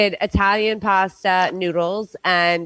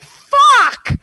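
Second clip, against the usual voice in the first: loud, higher burst of panic; panicked inner shout; 7 semitones higher, 4 dB louder than usual. Retold or imitated. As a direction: blurt it out in a flustered panic. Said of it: "So I was like".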